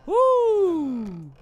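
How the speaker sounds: high, loud whoop; loud woo. Real, not acted. Streamer whooping as the jump works, loud whoop. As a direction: whoop with relief. Shouts in relief.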